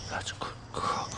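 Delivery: soft whispered praise; whispering. Real, not acted. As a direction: whisper it to the dog.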